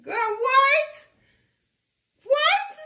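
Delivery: very high, loud "what?"; shocked disbelief. Real, not acted. Host reacts to the story's twist, shocked disbelief. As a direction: squeal it in disbelief.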